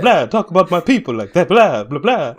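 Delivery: high, very loud, indignant vampire; mock vampire. Co-host playing an offended vampire defending his people, hammed-up loud and high. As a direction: shout it as an outraged cartoon Transylvanian. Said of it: "whatever that accent is".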